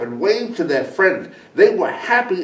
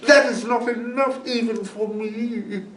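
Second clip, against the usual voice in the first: scoffing coyote; 7 semitones higher, 4 dB quieter than usual. A narrator's reading. Coyote laughs at the small bag, scoffing. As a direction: sneer at the small snack. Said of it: "laughed, and said".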